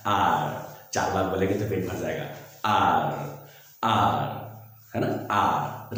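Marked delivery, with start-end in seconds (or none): 0.1-0.8 lower, drawn-out British 'ah' for आर; British आर with a silent r; British accent R. Shown as a demonstration. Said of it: "इसका रा वाला sound खा जाते हैं". 2.6-4.4 British आर with a silent r; British accent R. Shown as a demonstration. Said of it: "British accent में बोलेंगे". 5.3-6.0 British आर ending on an open vowel; British accent R. Shown as a demonstration. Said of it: "रा को sound नहीं करते".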